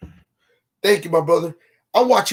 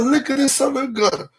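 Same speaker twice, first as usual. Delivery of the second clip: amused disbelief; 8 semitones higher than usual. Retold or imitated. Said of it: "I said".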